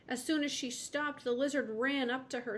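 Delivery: ordinary delivery, nothing marked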